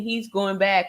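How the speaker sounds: usual speaking voice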